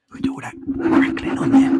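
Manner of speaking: playful whisper about crackles; ASMR whisper. Pretend. Streamer joking about ASMR crackling, whispered. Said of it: "We're gonna whisper".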